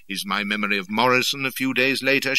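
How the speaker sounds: usual speaking voice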